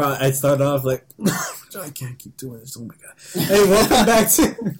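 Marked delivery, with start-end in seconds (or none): 3.5-4.8 high, loud mock show opener; narrator intro. Shown as a demonstration. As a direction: burst in like a hyped host. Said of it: "How would you narrate?".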